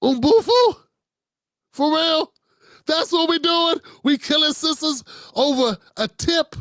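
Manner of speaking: high, stunned, can't believe this; incredulous outrage. Real, not acted. Host reacting to the braid shop clip, high incredulous outrage.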